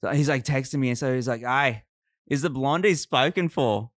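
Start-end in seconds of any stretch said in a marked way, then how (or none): 1.4-3.9 broad Aussie drawn vowels; Australian accent. Acted. Golfer asking about the blondie, Australian accent. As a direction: ask it like an Australian bloke. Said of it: "do a-, an Australian accent".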